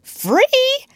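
very high, loud questioning yelp; astonished. A narrator's reading. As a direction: echo it in disbelief. Pirate astonished by captain's order.

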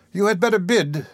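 high-pitched woman cutting in; brisk high interjection; interrupting. A narrator's reading. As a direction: break in firmly, pitched well up. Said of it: "interrupted Missus Bumble".

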